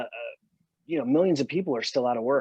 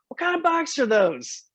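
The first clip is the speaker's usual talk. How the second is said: high-pitched mock West Virginia girl; hammed-up West Virginia twang, pitched high; terrible West Virginia accent. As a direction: imitate a West Virginia girl calling out, badly. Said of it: "terrible West Virginia accent".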